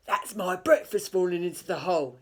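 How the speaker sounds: loud troll voice; a troll pleased his trap worked. A narrator's reading. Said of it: "he thought".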